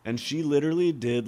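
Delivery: normal talking voice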